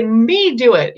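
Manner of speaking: a hint of a toddler demanding "Me do it". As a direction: play the little kid insisting "Me do it". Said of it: "if they say".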